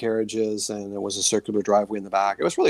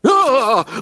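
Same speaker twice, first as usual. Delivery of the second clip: startled yelp; 8 dB louder than usual. Retold or imitated. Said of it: "all of a sudden he's like".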